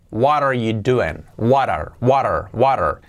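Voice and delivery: "What are you doing?" slowly, first two words as "wah-rar"; smoothly joined "water" for what are; linked words. Shown as a demonstration. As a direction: drill "water" with a tapped r for the t. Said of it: "hay que unir ahora las palabras".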